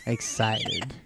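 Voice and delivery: deadpan. Acted. Deadpan sarcastic excitement.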